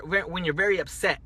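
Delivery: usual speaking voice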